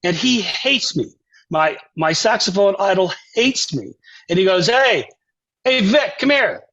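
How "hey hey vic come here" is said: high-pitched call to someone nearby; retold summons, pitched up; imitated calling out. Idol calls Vic over.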